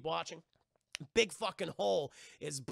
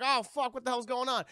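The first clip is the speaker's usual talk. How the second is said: higher, louder panicked question; alarmed; 6 semitones higher, 5 dB louder than usual. Retold or imitated. Elizabeth hearing the maids break in, loud and panicked. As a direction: blurt it out in panic.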